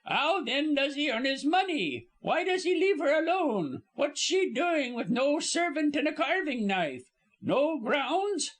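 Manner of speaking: high-pitched indignant Cockney servant; huffy, high gossip building her case. A narrator's reading. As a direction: fire off scandalised questions in a high voice. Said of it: "cried Missus Baskett indignantly".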